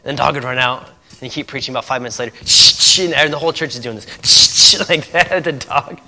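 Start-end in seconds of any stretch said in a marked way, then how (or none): 2.4-3.0 sharp loud shushing to chase a dog. Retold or imitated. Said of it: "That's how they yell at dogs". 4.2-4.8 sharp loud shushing to chase a dog. Retold or imitated. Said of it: "That's how they yell at dogs".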